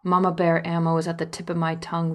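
plain reading voice

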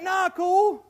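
high, loud casual brush-off; lightly acted easygoing scorpion; smooth-talking scorpion. Scorpion reassuring the frog, mock-casual. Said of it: "But the scorpion's like".

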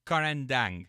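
Karandang run together in a single smooth word; said in one go. Real, not acted. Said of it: "say it in a whole, in one, one go".

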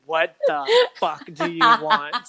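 fake-snap at the early riser, laughing; laughing half-angry what do you want; laughing mock outrage. Groggy friend woken too early.

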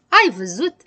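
a touch of pleased glee; gleeful. Acted. Habar-n-am catches his critic out, gleeful. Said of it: "se bucură".

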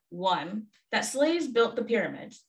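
normal talking voice

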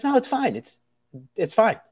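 a high, breezy brush-off from the server; dismissive server. Retold or imitated. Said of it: "She's like".

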